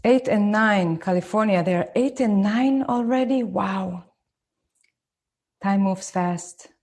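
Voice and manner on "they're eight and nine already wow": ordinary talk, nothing marked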